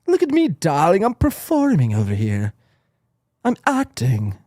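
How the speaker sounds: hammed-up theatrical luvvie, lightly voiced; mock diva. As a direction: prance through it like a vain actor.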